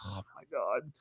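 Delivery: retold "oh God" under the breath; hushed dread. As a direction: say it quietly, as if sinking inside. Retells dread on being picked.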